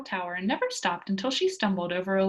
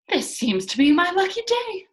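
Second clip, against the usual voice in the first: much higher and louder, triumphant; triumphant cry; 9 semitones higher, 8 dB louder than usual. A narrator's reading. Pippi holding up a spool, triumphant high cry. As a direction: crow with delight over the spool. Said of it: "another triumphant cry from Pippi".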